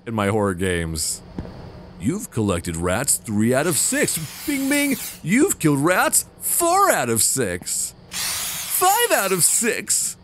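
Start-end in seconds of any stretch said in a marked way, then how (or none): 2.0-10.2 slow, drawn-out score announcement, climbing higher; hammed-up counter voice, pitch rising; mock game announcer. Mimics game's rat counter announcements.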